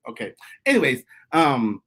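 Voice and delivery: ordinary talk, nothing marked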